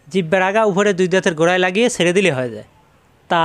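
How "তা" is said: তা with a long আ; elongated vowel. Shown as a demonstration. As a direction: draw out the vowel of তা. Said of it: "এখানে কিন্তু লম্বা করা যাবে". (লম্বা টানে তা)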